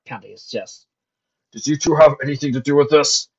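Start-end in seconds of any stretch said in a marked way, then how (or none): 1.5-3.3 sharp, louder questioning. A narrator's reading. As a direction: question them sharply, raised voice. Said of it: "he asked sharply".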